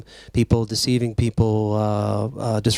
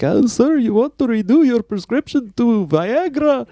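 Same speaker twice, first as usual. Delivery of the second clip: high, slow imitation of an Indian call-center accent; 12 semitones higher, 4 dB louder than usual. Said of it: "people with a heavy Indian accent".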